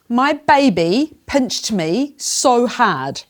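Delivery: put-on very slow, clear, stressing baby; teacher modelling the sentence slowly; slow model. Shown as a demonstration. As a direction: model every word slowly. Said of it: "Say it with me".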